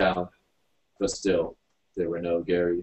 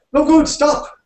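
very high, loud shriek; panicked shriek; 9 dB louder, slower than usual. A narrator's reading. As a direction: shriek in alarm at a reckless driver. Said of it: "shrieked the driver".

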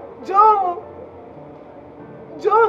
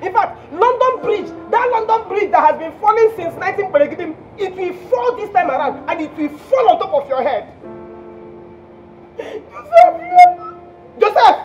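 high, loud outraged tirade; explode, then pile curse upon curse; furious cursing; 4 semitones higher, 4 dB louder than usual. Acted. Jilted caller cursing Joseph in London, loud high fury.

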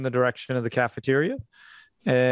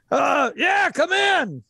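calling out; 13 semitones higher than usual. Acted. Stan calls to whoever is knocking. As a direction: shout it through the door. NPC calls through the door.

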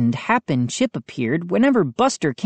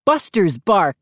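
plain reading voice

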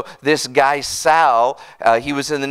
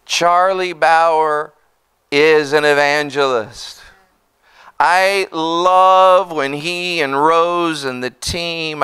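ordinary delivery

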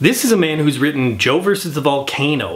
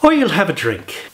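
put-on dialect coach's Irish accent; 4 semitones higher than usual. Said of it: "let's try it one more time".

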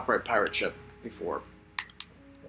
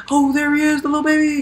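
cooing; 12 semitones higher than usual. Real, not acted. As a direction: coo over the puppy. Cooing at baby dog.

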